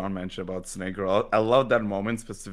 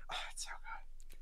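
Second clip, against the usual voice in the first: whisper; 18 dB quieter than usual. Retold or imitated. Makima intrigued, acted reaction.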